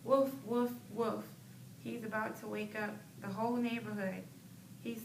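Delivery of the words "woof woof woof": barking woof woof, louder. Shown as a demonstration. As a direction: bark it out like a noisy dog. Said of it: "He's barking so loud".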